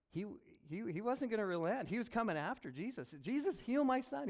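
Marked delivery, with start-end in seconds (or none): 3.2-4.3 high, urgent begging; a father crying for his son; desperate plea. Retold or imitated. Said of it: "wanting this thing so much".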